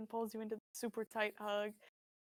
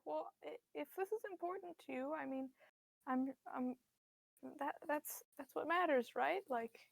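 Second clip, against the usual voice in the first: soft, higher voice of a tender mother; frail sympathetic mother; 4 semitones higher, 4 dB quieter than usual. Acted. Frail mother consoling her struggling son, soft and high. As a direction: comfort him softly.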